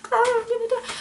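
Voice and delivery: high, teary wail; crying in fear. Retold or imitated. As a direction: sob it out, sure you're about to die. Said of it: "bawling at this time".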